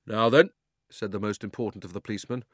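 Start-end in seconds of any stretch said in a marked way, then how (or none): 0.0-0.5 loud, official call; policeman. A narrator's reading. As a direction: take charge of the crowd. Policeman takes charge.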